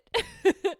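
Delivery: a giggle jumping up high; sudden high giggle; high burst of laughter. Real, not acted. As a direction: laugh out loud at yourself. Laughing at her own cheek.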